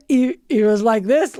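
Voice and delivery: ordinary delivery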